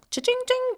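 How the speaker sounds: high, ringing cha-ching; imitated cash-register ching. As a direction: sing out a mock register sound. Imitates file popping out.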